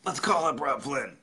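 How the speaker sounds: high-pitched rowdy party voice. Retold or imitated. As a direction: imitate a buddy hatching a drunken idea. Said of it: "[name] was like".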